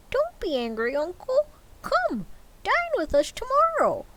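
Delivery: high, cracking plea; pleading nephew. Acted. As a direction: beg your uncle warmly.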